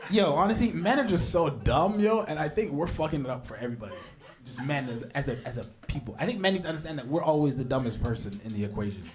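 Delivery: ordinary talk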